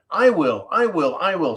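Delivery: eager animals. Acted.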